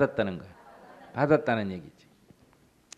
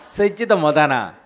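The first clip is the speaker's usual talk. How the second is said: loud, raised retort; angry shout; 5 semitones higher, 11 dB louder than usual. Retold or imitated. Beggar keeps shouting at the owner. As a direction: throw the question back in anger. Acted quarrel continues.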